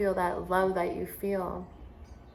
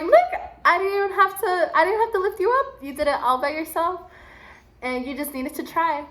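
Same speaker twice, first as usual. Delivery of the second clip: much higher, louder proud teacher; excited praise; 9 semitones higher, 5 dB louder than usual. Retold or imitated. Teacher praising the boy who climbed alone, high excited praise. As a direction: gush with pride at the kid.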